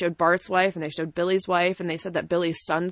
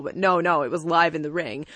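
normal talking voice

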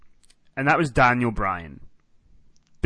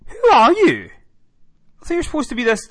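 mock disbelief; 9 semitones higher, 7 dB louder than usual. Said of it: "and you're like".

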